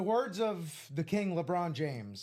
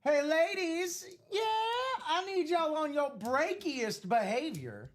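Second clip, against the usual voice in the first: [name] quote; 7 semitones higher than usual. Retold or imitated. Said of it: "You know, from [name]".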